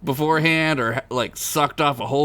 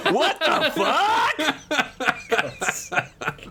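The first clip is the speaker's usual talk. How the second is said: startled; 13 semitones higher, slower than usual. Acted. Vampire spots rat with gun.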